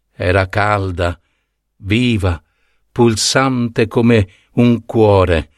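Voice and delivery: ordinary delivery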